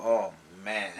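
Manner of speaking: ordinary delivery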